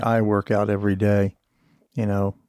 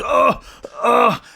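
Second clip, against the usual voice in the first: high strained exertion grunts; 11 semitones higher than usual. Retold or imitated. Man straining through trainer's exercises, high pained grunts. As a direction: grunt like pushing through a hard rep.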